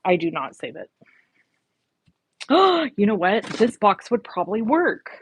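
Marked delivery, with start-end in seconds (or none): 2.4-2.9 surprised gasp. Real, not acted. Finds a useful box.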